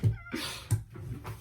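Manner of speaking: high, wheezy, quiet laugh; helpless soundless giggle. Real, not acted. Laughs at his vow.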